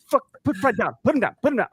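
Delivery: mock panic. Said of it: "I'm like".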